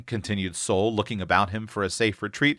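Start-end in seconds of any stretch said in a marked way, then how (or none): none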